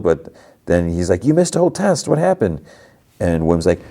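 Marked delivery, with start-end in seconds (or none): 1.2-2.6 put-on high-pitched, startled kid; astonished questioning. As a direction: act out a friend's amazed questioning. Startled friend questions Wim.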